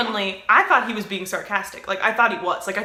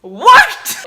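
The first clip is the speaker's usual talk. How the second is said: incredulous; 12 semitones higher, 15 dB louder than usual. Real, not acted. Disbelief at strike two.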